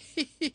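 high, put-on hoo-hoo-hee giggle; hammed-up storybook king's laugh; hooting chuckle. King laughing at his own joke, high hooting giggle.

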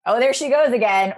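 lightly voiced husband sighing at her; a hint of the amused husband; mock husband teasing.

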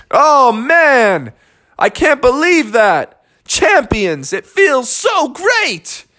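high, gushing celebration, last word stretched; elated winners. Acted. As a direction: exult like a new champion. Winners rejoice at victory.

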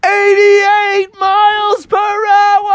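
very high, slow, loud hamster proclamation; dramatic hamster shout. Acted. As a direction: shout it slowly in a tiny hero voice. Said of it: "the little saber-tooth hamster was like".

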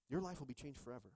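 ordinary delivery

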